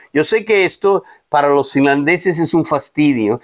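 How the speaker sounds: usual speaking voice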